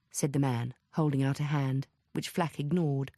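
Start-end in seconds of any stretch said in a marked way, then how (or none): none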